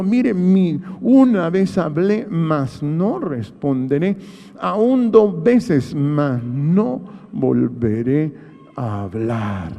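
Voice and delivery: drawn-out, each phrase weighed slowly; slow solemn scripture quote. Retold or imitated. Preacher reading Job's vow to the congregation, slow and solemn. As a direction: read Job's vow slowly, like a sacred promise. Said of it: "Y Job dijo".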